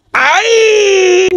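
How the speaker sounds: high, drawn-out playful "ay"; mock squeal. Host teasing blindfolded man about a kiss, mock squeal.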